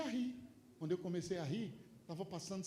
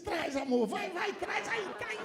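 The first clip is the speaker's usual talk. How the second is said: high and louder, drawn out eagerly; 12 semitones higher, 7 dB louder, slower than usual. Retold or imitated. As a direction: beckon it in with glee. Eager to receive payment. (ansioso, agudo e mais alto)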